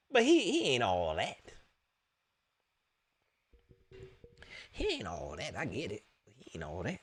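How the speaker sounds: low, soft, scornful sing-song; mock dismissal. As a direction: brush him off with a sneer. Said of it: "mocking dismissive voice".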